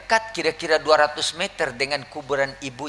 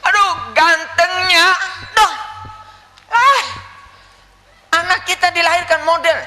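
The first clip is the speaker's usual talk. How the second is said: gushing admiration; 12 semitones higher, 8 dB louder than usual. Acted. Admirer holding the handsome baby, loud gushing.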